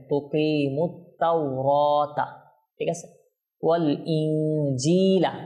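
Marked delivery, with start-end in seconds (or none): 0.1-2.3 very slow তুকিমুত তাওরাতা, catching the t directly after "mu"; joined recitation. Shown as a demonstration. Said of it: "তাকে directly এখানে ধরবে". 3.6-5.3 slow ওয়াল ইনজিলা with a held, hidden nasal n and distinct j; ikhfa recitation. Shown as a demonstration. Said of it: "ইকফা হবে এখানে".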